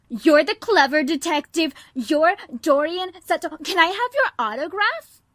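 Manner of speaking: loud, high gushing fan; breathless autograph hunter; starstruck fan. Acted. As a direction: fawn over the famous detective. Starstruck fan greets detective.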